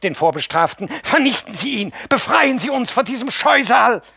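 screaming high, voice breaking over; voice cracking. Acted. Mascha screams at the aunt to destroy him, voice cracking. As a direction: scream it until the voice gives way. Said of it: "überschlägt sich Maschas Stimme".

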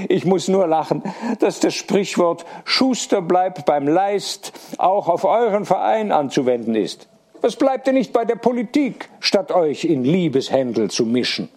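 high-pitched, lightly voiced teasing wife; amused wife. A narrator's reading. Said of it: "so zum Lachen gereizt".

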